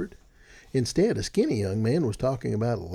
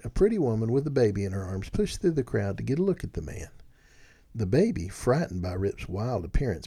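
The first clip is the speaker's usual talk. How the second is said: ordinary delivery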